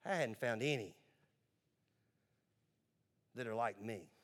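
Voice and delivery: low, soft, halting report; acted dejected Adam. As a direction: let it drop, quiet and spaced out. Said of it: "goes back to God and says".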